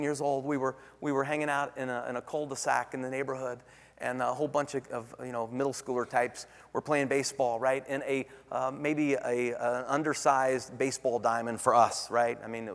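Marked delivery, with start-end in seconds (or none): none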